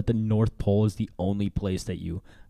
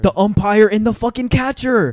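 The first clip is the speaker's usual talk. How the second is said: high, loud triumphant answer; excited shout; 11 semitones higher, 7 dB louder than usual. Real, not acted. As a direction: shout it as you solve it.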